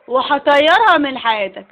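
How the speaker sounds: slow, loud comic threat. Acted. Friend threatening to drive away the wife, loud slow threat. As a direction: spit the threat out slowly. Threatens to ruin his marriage.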